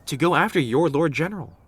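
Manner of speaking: loud, high, flustered blurt; flustered outburst. Acted. Flustered servant blurts out the reason.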